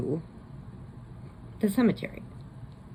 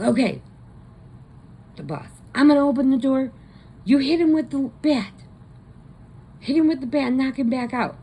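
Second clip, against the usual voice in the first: high, loud, rushed instructions; the boss, tense and fast, giving the plan; urgent orders; 9 semitones higher, 6 dB louder, faster than usual. Retold or imitated. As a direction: bark the plan fast before the door opens. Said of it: "So the guy goes".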